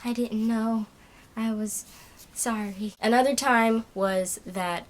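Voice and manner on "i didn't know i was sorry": soft unvoiced whisper, barely there; hushed sheepish sorry; whispered apology. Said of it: "I'm like".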